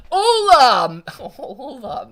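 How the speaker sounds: high, loud laughing "Hola!"; giggling greeting to the coworkers. Real, not acted. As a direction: burst out a laughing hello.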